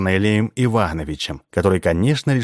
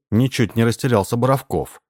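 ordinary delivery

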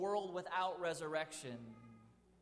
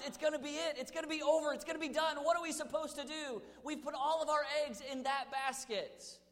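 anxious despair; 10 semitones higher, 5 dB louder than usual. Retold or imitated. Voicing despairing believers.

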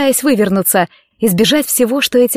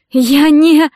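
high, loud tearful fragment; acted whimper, cut short; sobbed protest; 5 semitones higher, 5 dB louder than usual. A narrator's reading. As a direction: start a protest and break off. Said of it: "всхлипнула Кэтрин".

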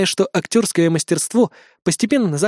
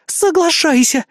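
high, slow, loud eager cry; exclaimed; 11 semitones higher, 3 dB louder, slower than usual. Acted. Eris urges Mark to agree, exclaiming. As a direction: shout it out eagerly, pitched up. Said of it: "воскликнула Эрис".

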